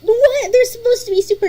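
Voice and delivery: loud, high shocked "What?". Real, not acted. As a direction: blurt it out in disbelief. Shocked at Canadians called mean.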